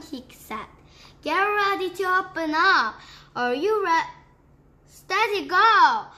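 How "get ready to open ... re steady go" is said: fast, rising and louder to a shouted go; excited countdown. Acted. Duke urges the pelican to open up, excited and loud. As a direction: build the countdown to a burst.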